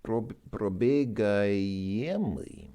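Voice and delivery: probegaiemli spelled out syllable by syllable, slow; slow careful pronunciation. Shown as a demonstration. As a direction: sound out probegaiemli slowly for the learner. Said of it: "passive participle form here is".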